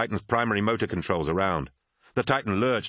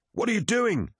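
much higher, alarmed question; acted yell; 14 semitones higher than usual. A narrator's reading. Crewmate challenging Titus mid-fight, yelled. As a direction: yell it in high-pitched disbelief. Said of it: "yelled Arukin".

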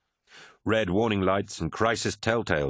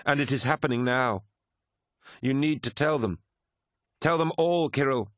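high, urgent, insistent; suddenly strong voice; 7 semitones higher than usual. A narrator's reading. Keeler urging Kirill to tell them all, suddenly strong. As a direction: press the command home, rising in pitch. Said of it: "Her voice suddenly strong".

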